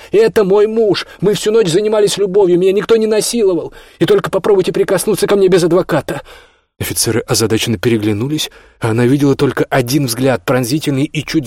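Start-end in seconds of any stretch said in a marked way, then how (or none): 0.1-6.2 loud, high, indignant tirade; breathless shouting. A narrator's reading. Olya defending her husband to police, shouting indignantly. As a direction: defend him in a heated high cry. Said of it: "задыхаясь, крикнула она".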